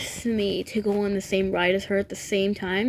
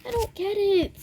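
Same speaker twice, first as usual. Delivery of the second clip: much higher, squeaky disbelief; high-pitched bafflement; 11 semitones higher than usual. Real, not acted. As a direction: squeal it in helpless confusion. Giggly bafflement, cut off afterwards.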